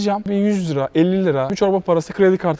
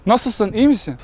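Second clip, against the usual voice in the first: usual speaking voice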